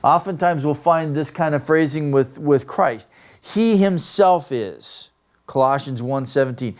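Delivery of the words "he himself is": "He himself" high and drawn out; raised, deliberate weight on "He himself"; emphatic HE HIMSELF. Shown as a demonstration. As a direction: slowly underline "He himself". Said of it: "Emphatic position".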